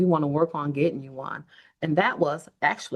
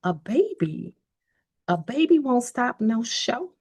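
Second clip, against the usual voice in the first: a hint of mom, slow and a bit higher; mom's saying; 7 semitones higher than usual. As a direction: mom voice, only lightly done. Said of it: "She said it just like that".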